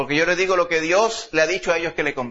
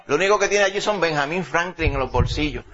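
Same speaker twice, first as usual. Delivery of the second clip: ordinary talk, nothing marked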